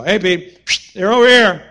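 calling out. Retold or imitated. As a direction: call out to the boat from shore. Jesus calling from shore.

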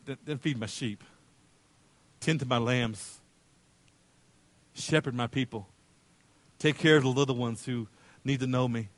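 soft, low, tender instruction; gentle charge. Acted. Jesus entrusts his people to Peter, gently. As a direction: entrust it calmly and quietly.

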